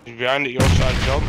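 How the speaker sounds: loud urgent call-out; shouted warning. Real, not acted. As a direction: yell the warning across. Warning teammate of enemy.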